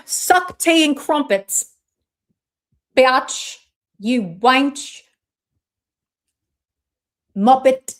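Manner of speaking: loud, hammed-up English insults; mock English accent. Streamer trying bad words for listeners, mock English accent. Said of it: "like, with an English accent".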